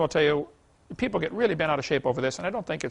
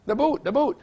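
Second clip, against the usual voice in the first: insistent mock English accent, much higher; 8 semitones higher, 3 dB louder than usual. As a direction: repeat it like an Englishman, pitched up. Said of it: "just some of the things he would say".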